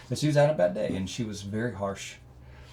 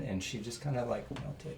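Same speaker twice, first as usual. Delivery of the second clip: usual speaking voice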